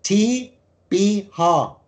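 letters T, B, H spaced slowly; careful spelling. Shown as a demonstration. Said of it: "Jetzt genau aufpassen".

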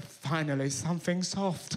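masseur quipping after an accidental brush; posh masseur. Retold or imitated. Low mock posh relief. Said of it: "he looked to me and went".

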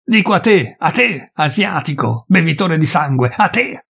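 high jabbing yells; furious accusation. Acted. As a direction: point at him with every word. Peasant insulting the forester.

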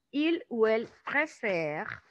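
"préfère" said slowly, final e unsounded; silent ending. Shown as a demonstration. Teacher showing third person, "préfère" with silent ending. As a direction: spell out slowly "Il ou elle préfère", stopping on the r. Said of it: "no la estamos pronunciando".

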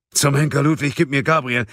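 high, heated cursing order; impatient demand. A narrator's reading. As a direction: demand it with rising exasperation. Munk loses patience on the phone. (ungeduldig und aufgebracht fordern)

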